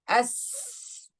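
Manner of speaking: As with the s let out as a free flow of air; hissed s. Shown as a demonstration. Teacher shows class rakhawa, letting s flow. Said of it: "rakhawa, flow of air".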